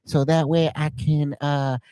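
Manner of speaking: hesitant, earnest boxer impression; Mike Tyson. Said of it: "All right, what about Mike Tyson?".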